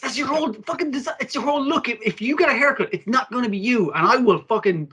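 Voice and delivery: high, worked-up protest over a haircut; heated mock outrage. Real, not acted. Passionately defending character design.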